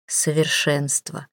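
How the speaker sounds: low, slow breath of a word; awed murmur. Acted. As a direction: murmur it, lost in admiration. Man murmurs in awe.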